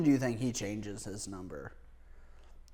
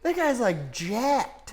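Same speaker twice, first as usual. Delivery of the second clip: amazed; 10 semitones higher, 3 dB louder, much slower than usual. Real, not acted. Amazed at an actor.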